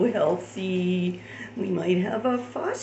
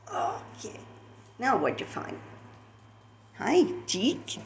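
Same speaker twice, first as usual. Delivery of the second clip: ordinary delivery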